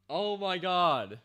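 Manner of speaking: slow, drawn out and loud; stretched-out delighted gasp; awed delight. Real, not acted. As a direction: savour it in amazement. Awed by the scene.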